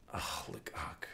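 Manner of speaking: whispered anguished sigh; despairing whisper. Real, not acted. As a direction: breathe it out in despair. Another miss during match.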